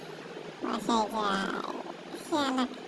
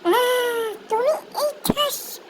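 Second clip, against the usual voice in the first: high cry of pain from a slap; 10 semitones higher, 3 dB louder, much slower than usual. Acted. Slapped man crying out at the madam. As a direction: yelp as the slap lands.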